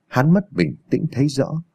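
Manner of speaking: ordinary delivery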